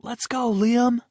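high, loud cheering scream; mother screaming. A narrator's reading. Mother screaming from the stands to her son. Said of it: "my mother scream from the stands".

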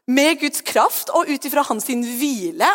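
ordinary talk

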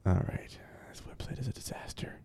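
under-the-breath whisper at the screen; whispered grumble to himself. Real, not acted. S0 whispers while struggling with a website.

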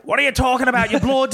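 very high, hammed-up northern holler; mock shouting. Brother to whispering sister, high mock shouting. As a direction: holler like someone who can't hear. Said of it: "I can't hear you over me shouting".

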